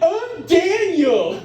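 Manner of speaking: high, stretched-out mock exclamation; misheard enthusiastic introduction. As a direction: announce it like a gushing cheer. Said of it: "she thinks I went".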